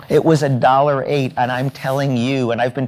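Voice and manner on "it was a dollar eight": normal talking voice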